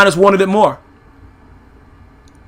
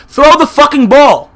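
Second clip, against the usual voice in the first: frustrated shout; 4 semitones higher than usual. Real, not acted. Fan yelling at the coach about play calling, furious shout. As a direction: yell it high and hard, fed up.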